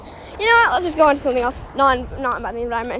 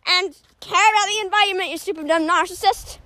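preachy vegan teacher; 5 semitones higher than usual. Acted. Telling listeners to buy electric cars, preachy vegan teacher. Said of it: "that vegan teacher inside of me".

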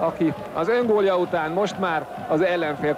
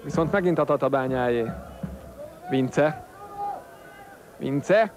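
normal talking voice

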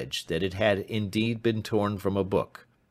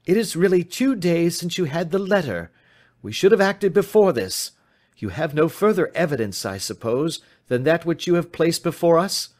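higher, forceful questioning; urgent reproach; 10 semitones higher, 5 dB louder than usual. Acted. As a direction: press the client hard, pitch raised. Detective presses client urgently.

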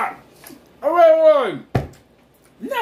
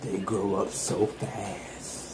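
very low, soft and slow; drawn-out, low mock sentiment; mock wistful; 13 dB quieter, slower than usual. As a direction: play the misty-eyed parent, hushed. Mock sentimental remark on child.

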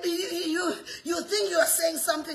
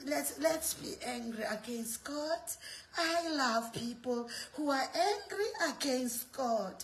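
low, hushed, coaxing devil; devil's voice; 5 semitones lower, 7 dB quieter than usual. Acted. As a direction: play the devil softly luring someone in. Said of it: "He's saying".

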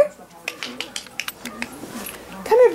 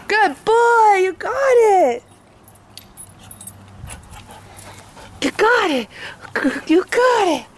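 excited dog praise; 7 semitones higher than usual. Real, not acted.

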